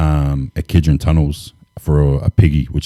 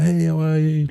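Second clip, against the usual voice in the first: much higher, singled-out friendly hello; cheery greeting; 12 semitones higher, 3 dB quieter than usual. Retold or imitated. Worker greets passing workmates at the barbecue, cheery greeting.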